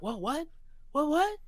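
high, rising disbelief; shocked squeal. Retold or imitated. Voicing shocked viewer.

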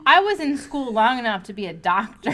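real laughter through the boast; laughing. Real, not acted. Laughing at the imagined snub, still giggling. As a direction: laugh out the lines.

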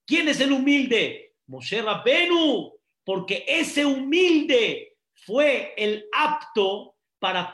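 loud, high fervent preaching; preaching at full force. Acted.

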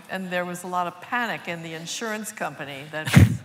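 ordinary delivery

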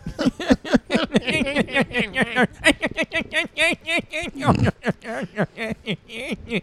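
long high chattering cartoon cackle; imitated silly laugh. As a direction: chatter on and on like a stuck loop. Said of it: "I like when you do that voice".

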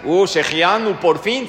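loud, sarcastic sigh of relief; loud teasing GPS at last; mock sarcastic GPS. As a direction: tease the late arrivals. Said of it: "Qué te dice el Waze".

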